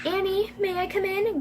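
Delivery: lightly acted girl asking to come in; lightly voiced little sister.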